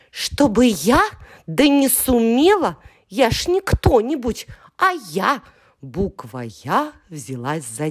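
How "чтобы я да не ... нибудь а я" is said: high, loud scoffing boast; proud little letter, offended; indignant boast. Acted. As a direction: scoff at the very idea. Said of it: "Я boasts in reply".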